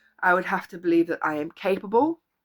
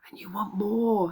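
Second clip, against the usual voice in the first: whispered; 4 dB quieter than usual. Acted. Building motivational list.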